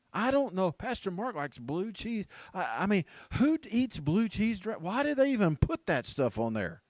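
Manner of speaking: high, loud disbelief; hammed-up outrage over a trivial thing; mock incredulous. Mock outrage over blue cheese.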